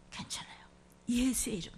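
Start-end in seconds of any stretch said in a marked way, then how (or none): 0.1-0.6 whisper. Real, not acted. Whispered reassurance to the sick.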